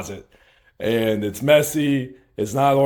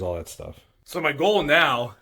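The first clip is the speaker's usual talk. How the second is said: ordinary talk, nothing marked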